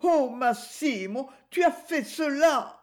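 the ex-wife groaning as she learns the truth; moaning. A narrator's reading. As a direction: let it break into a pained cry. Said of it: "eut un gémissement".

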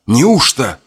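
usual speaking voice

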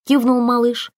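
usual speaking voice

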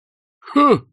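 high, loud burst of amazement; astonished. A narrator's reading. Soldier struck by the wood-goblin idea, astonished. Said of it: "поразился такой мысли Свинцов".

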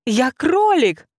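very high, loud, slow creature screech; indignant rabbit shriek. Acted. Rabbit outraged at being called a hare. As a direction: shriek the correction.

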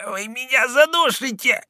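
high, strangled cry; choking rasp. A narrator's reading. Villain being strangled by the soldier, choking rasp. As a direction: squeeze it out as if throttled. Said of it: "задыхаясь, хрипел Роден".

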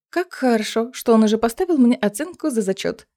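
ordinary delivery, nothing marked

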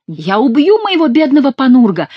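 high, loud lament; plaintive cry. A narrator's reading. Monk appeals to Chicot for his donkey, plaintive cry. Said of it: "жалобно кричал монах".

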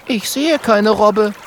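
high, slow character voice; high-pitched little sceptic; puzzled girl figure. A narrator's reading. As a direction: say it high and doubtful.

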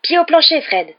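loud, high shout dropping on the last word; urgent yell. A narrator's reading. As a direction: yell to the driver to go. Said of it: "hurla Ron". (hurlement pressant)

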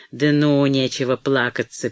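plain reading voice

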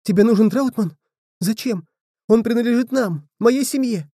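put-on a boy clinging to his dog; frightened boy. A narrator's reading. Blodi protests, frightened.

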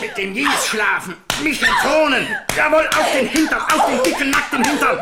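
high, loud, laughing rage; triumphant shouting with laughter; gleeful furious yelling. Acted. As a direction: shout each word as you spank her. Brother spanking his sister.

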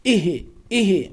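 "إه" with an echo vowel, ihi; bounced ha. Shown as a demonstration. Teacher shows the error, bouncing ha in "إه". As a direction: release the h of "إه" into a small vowel. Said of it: "بقلقلة الهاء".

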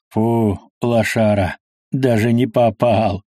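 usual speaking voice

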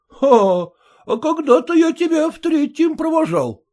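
выше, оживлённо; добродушная перепалка; разговор приятелей. Acted. Спор учителя и Самоплясова. (приятели оживлённо спорят)

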